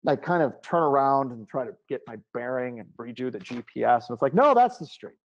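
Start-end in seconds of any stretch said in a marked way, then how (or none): 4.3-5.2 high, loud, insistent retort; emphatic, pitched-up correction; insistent GPS. Retold or imitated. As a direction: voice a GPS that insists it's right. GPS voiced insisting on route.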